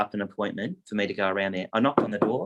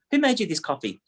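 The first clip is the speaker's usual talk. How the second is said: mum demanding; 7 semitones higher than usual. Retold or imitated. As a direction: demand an answer like a suspicious mum. Mum questioning son.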